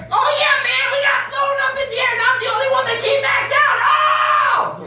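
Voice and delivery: very high, shouted fake war tale; wild mock veteran yelling; hammed-up war story. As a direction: blast the tall tale for a reaction. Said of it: "I had to give him what he wanted".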